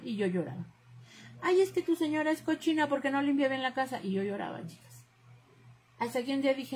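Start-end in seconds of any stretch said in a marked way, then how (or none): 1.4-4.0 high-pitched imitation of a tattling critic; put-on high voice of criticism; mock complaining relatives. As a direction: mimic their nagging complaint, pitched well up. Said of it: "Me dicen".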